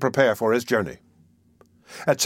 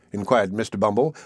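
ordinary delivery, nothing marked

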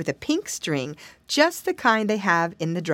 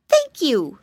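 polite little pig, high and louder; Flop, very polite; 7 semitones higher, 5 dB louder than usual. A narrator's reading. Piglet thanking his brother, high and polite. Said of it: "spoke Flop most politely".

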